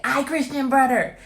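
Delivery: put-on loud high toddler yell; toddler shouting. Repeats toddler quote.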